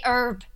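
"herb" said American-style as "erb"; silent H. Retold or imitated. Briton questions America's spokesperson, "herb" said "erb" without H. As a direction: drop the H from "herb". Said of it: "It's not an herb".

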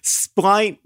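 splint said as "splynt"; long i in splint, as in pint; rhymed with pint. Shown as a demonstration. Co-host rejecting the new word, splint rhyming with pint. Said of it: "make them rhyme with pint".

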